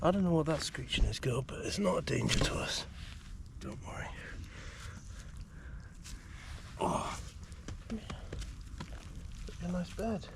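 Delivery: soft whispered reassurance; whispering. Real, not acted. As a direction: hush the dog down gently. Calming the dog at night.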